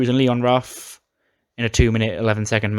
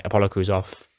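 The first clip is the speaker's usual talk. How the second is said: ordinary talk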